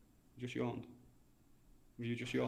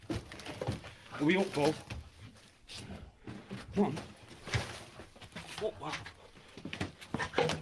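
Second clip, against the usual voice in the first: whispered, slow; frightened whisper; 2 semitones lower, 8 dB quieter, much slower than usual. Real, not acted. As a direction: whisper it in fear. Scared hunter whispering to leave.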